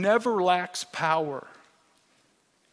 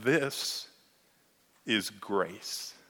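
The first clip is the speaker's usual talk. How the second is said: softer, catching on the words; choked with emotion; 7 semitones lower, 8 dB quieter, much slower than usual. Real, not acted. Pastor moved while quoting a friend, choked up. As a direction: let real emotion thin the voice. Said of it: "I'm sorry when I get emotional".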